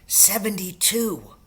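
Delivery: ordinary delivery, nothing marked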